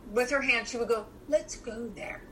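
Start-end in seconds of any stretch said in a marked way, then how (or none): 1.3-2.2 soft unvoiced whisper; sweet whisper. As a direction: whisper it sweetly, like a kind therapist. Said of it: "Very sweetly".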